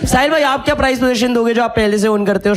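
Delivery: normal talking voice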